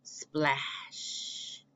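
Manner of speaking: soft, breathless sound word; whispered splash. Acted. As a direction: hush it like a quiet splash. Duck dives into pond.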